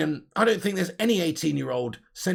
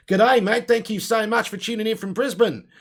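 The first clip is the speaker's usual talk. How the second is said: hammed-up Australian greeting, pitched higher; loud, jokey mock-Australian; bad Aussie accent; 6 semitones higher, 5 dB louder than usual. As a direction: put on a cheesy Aussie twang. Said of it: "shit Aussie accent".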